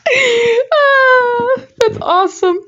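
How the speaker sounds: high, loud squealing laugh into words. Real, not acted. Reader cracking up at cock block line, high loud laughing. Laughing at her old writing.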